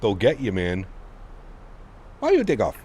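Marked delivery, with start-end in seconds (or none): 2.2-2.8 put-on much higher Italian controller accent; mock Italian accent. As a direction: squeal it like an indignant Italian controller. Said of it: "In a nice Italian accent".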